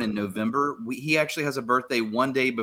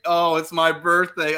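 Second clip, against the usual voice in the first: slow, louder mock acceptance; slow playing-along roommate; slow dawning realization; 4 semitones higher, 5 dB louder, slower than usual. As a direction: drag it out like it just sank in. Roommate slowly plays along.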